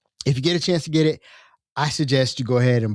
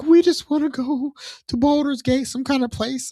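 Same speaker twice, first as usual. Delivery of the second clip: tiefling voice; 12 semitones higher than usual. Retold or imitated. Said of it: "the tieflings that are like".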